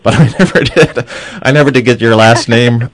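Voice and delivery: laughing through the words. Real, not acted. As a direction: chuckle while admitting it. Host admits not knowing name.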